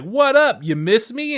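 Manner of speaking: high, loud and swaggering; cocky AI voice. Retold or imitated. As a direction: burst in like a cocky robot buddy. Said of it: "the A I comes back and".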